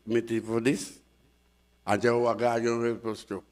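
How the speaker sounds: usual speaking voice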